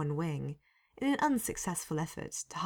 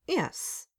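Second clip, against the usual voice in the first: higher, stronger yes; 10 semitones higher than usual. A narrator's reading. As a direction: answer up at last. Told to speak up.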